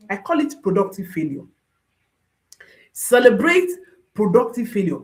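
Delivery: usual speaking voice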